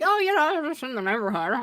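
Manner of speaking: breezy, innocent-sounding quote; mock casual. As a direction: play it off as totally casual. Teasing friend about stalking.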